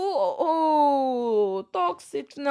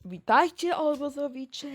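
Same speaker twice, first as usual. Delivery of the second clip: ordinary talk, nothing marked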